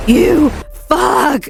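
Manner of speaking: high, anguished mock cry; reenacting a tearful curse; crying outburst. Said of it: "I'm crying".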